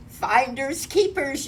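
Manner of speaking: high, loud claim; yelling bear. A narrator's reading. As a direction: call it out like an excited bear. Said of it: "yelled the bear".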